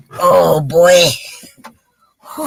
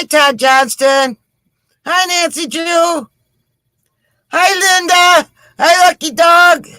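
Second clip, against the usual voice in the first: cheerful greetings; 8 semitones higher than usual. Real, not acted. Greeting viewers by name on stream.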